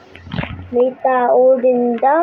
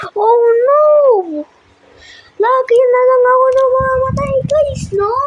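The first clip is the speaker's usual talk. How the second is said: exasperated; 8 semitones higher than usual. Real, not acted. Dies again, exasperated.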